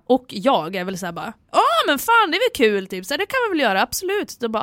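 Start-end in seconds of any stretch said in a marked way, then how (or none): 1.5-4.4 high, eager party-goer agreeing; hammed-up keen agreement; enthusiastic yes. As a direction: jump at the invitation, pitch up. Acts out drunk enthusiastic agreement.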